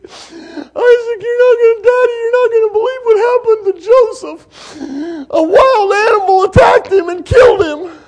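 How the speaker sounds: high, loud, mock-sobbing lament; fake wailing. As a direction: wail it like brothers faking grief. Brothers feign grief to father.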